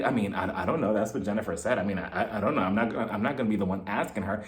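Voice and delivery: low, hushed, stammering reply; playing along. [name] answers Tracy's whisper, hushed and evasive. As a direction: imitate a secretive whispered excuse. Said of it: "she's just playing along with her".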